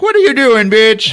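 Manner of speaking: high, loud jeering yell; mocking shout. Prisoners laughing at the boy, a high loud jeer. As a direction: jeer at the kid at the top of your voice.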